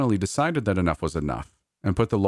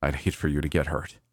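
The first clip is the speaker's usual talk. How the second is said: worried; 3 semitones lower than usual. A narrator's reading. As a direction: say it gently, meaning it. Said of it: "genuinely worried".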